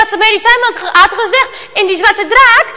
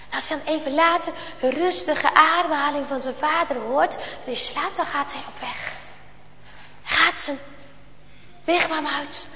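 much softer, secretive narration; soft sneaking storytelling; hushed; 3 semitones lower, 12 dB quieter than usual. A narrator's reading. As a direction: hush it so father won't wake. Sneaking out while father sleeps.

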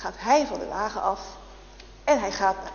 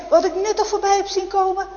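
higher, louder surprised cry; gatekeeper's exclaim; 9 semitones higher, 7 dB louder than usual. Acted. Gatekeeper to soldiers about the escaped heretic, loud and high. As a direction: blurt it in disbelief.

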